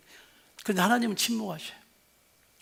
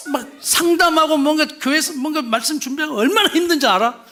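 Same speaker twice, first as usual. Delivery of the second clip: indignant husband; 6 semitones higher, 5 dB louder than usual. Retold or imitated. Husband's retort continues.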